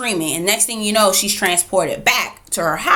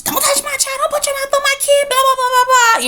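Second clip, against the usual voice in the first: very high, fast, shrill-pitched hysterical rant; mother going off. Retold or imitated. Mother yelling at Dana not to touch her kid, in a very high hysterical rant. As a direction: rattle it off like a frantic mother losing it. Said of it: "going off".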